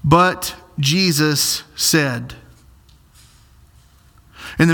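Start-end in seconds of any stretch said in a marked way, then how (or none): 0.0-2.3 very slow, weighty "But Jesus said"; slow emphasis. Shown as a demonstration. Preacher slowing down for his flock. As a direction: lean on each word of "But Jesus said" slowly. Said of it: "Notice what Mark said there".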